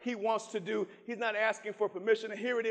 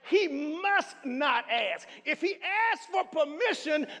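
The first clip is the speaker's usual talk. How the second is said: high, driving sermon delivery; full-force preaching; 7 semitones higher, 4 dB louder than usual. Real, not acted. Preacher telling congregation the king never asks permission, full-force preaching. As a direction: preach it hard, stretching the final words.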